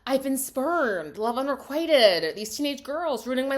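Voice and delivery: over-the-top spurned lover; melodramatic lament. Retold or imitated. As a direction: ham up the heartbroken hero. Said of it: "he's like".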